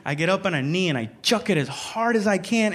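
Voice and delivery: ordinary talk, nothing marked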